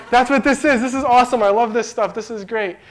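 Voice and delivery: wife overjoyed at the steak in the bag; thrilled wife. Retold or imitated. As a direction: squeal it out like a delighted wife.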